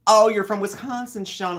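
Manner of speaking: high, loud mock surprise; surprised. Real, not acted. Reading a chat comment from Sean, loudly surprised. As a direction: caught off guard by a viewer's hometown. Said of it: "answering a viewer comment".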